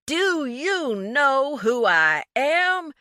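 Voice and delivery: outraged rich man hollering into the phone; hollering at top of lungs. A narrator's reading. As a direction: holler every word down the line. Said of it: "James hollered at the top of his lungs".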